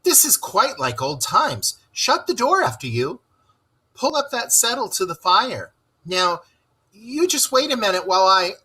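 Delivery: high, busy host chatter; a hint of a flustered host; lightly voiced fussy Mole. A narrator's reading. Mole bustling about his guests, lightly voiced and high.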